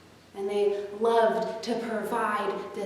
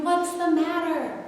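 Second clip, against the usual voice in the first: higher, worried question; exclaimed; 8 semitones higher than usual. Acted. The toys asking the stuck train, raised and surprised.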